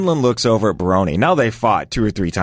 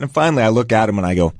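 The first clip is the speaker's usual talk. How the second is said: ordinary delivery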